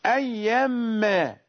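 أيا ما drawn out slowly as a stopping point; slow down and stop on أيا ما; pause on أيا ما. Shown as a demonstration. Teacher showing students where to stop. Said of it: "إذا أوقفك شيخك على هذه الكلمة".

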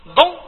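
دون said high and loud with a heavy ض-like d; insisting on the Arabic ض in a French word; emphatic French don. Shown as a demonstration. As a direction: punch out دون with a thick emphatic d. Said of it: "حتى نقولوا ضون".

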